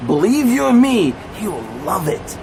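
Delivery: normal talking voice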